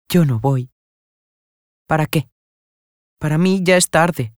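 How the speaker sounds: ordinary delivery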